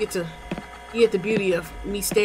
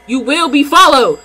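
louder threat; 3 semitones higher, 9 dB louder than usual. Real, not acted. Threatening in-game thief.

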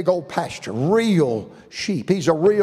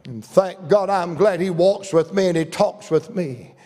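ordinary talk, nothing marked

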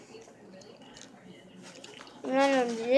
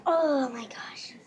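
whispering; 4 dB quieter than usual. Real, not acted. Whispering while tasting candy.

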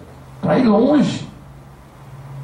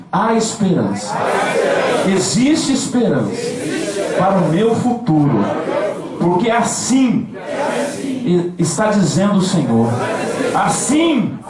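faith declaration; slower than usual. Shown as a demonstration. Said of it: "Fala assim".

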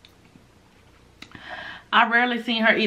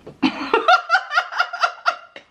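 high delighted laugh. Real, not acted. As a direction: burst out laughing. Laughs at own joke.

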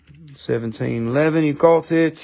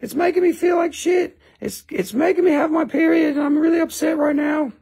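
hammed-up panic, pitched way up; mock triggered Amber. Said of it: "She's like".

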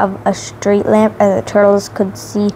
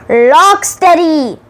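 higher, louder, slow cartoon call; character voice; 7 semitones higher, 4 dB louder, slower than usual. A narrator's reading. Narrator lists Superfly's crew, goofy cartoon call. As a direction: stretch the name in a goofy voice.

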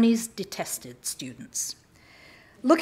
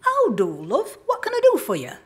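friendly, higher motherly greeting; pleasant shopkeeper; 6 semitones higher, 4 dB louder than usual. Retold or imitated. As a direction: greet him like a kindly mum. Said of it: "and said pleasantly".

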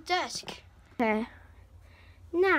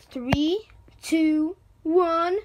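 louder with each number; countdown shout; 3 semitones higher, 7 dB louder, much slower than usual. Pretend. Countdown before teleporting.

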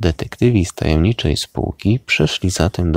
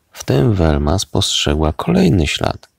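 ordinary delivery, nothing marked

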